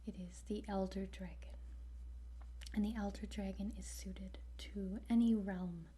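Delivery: usual speaking voice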